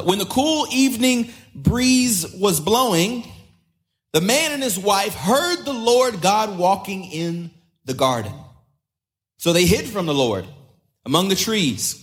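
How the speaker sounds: slow dramatic scripture reading. Acted. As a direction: read the Bible passage with drama. Reading God calling in Genesis.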